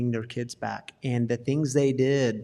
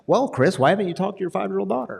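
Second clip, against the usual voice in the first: higher, slightly louder mock questioning; quick, put-on nosy challenge; imagined shaming questioner; 7 semitones higher, 3 dB louder, faster than usual. Host imagining someone questioning Chris, mock shaming. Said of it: "in some situations that's shame-based".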